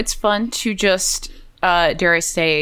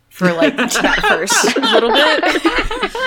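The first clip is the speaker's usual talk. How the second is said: laughing; 8 semitones higher, 4 dB louder, much slower than usual. Real, not acted. Recalling mad Thorin.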